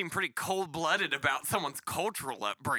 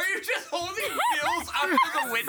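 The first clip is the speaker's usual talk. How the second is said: asking through real laughter, pitched up; laughing question; 13 semitones higher than usual. Real, not acted. Co-host teasing the teller about the bills, laughing incredulously.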